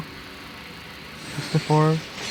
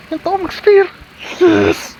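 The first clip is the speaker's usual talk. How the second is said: excited; 8 semitones higher, 5 dB louder than usual. Real, not acted. Finally got the spear.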